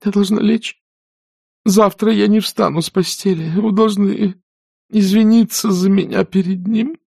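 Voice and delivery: high, feeble fussy aunt; a fluttery invalid begging off; sickly plea. A narrator's reading. Aunt Pittypat begs the girls to apologize for her.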